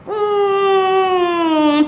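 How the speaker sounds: long, high, drawn-out hum; doubtful hum. Retold or imitated. Mother doubting the pharmacist's dose, drawn-out doubtful hum. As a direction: hold a high, sceptical "mm".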